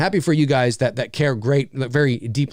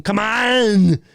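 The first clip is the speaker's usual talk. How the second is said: higher, strained catchphrase impression; Arnold; 6 semitones higher, 4 dB louder than usual. Shown as a demonstration. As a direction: do a hammed-up action-star shout. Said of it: "and then just saying".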